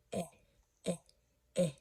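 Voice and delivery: "eh" as an isolated short vowel, softly repeated; short E sound. Shown as a demonstration. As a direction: say eh, eh, eh quietly, each a separate short E. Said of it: "that's the short sound".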